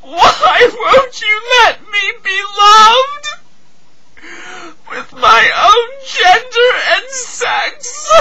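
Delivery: anguished tearful cry climbing in pitch; sobbing plea. Acted.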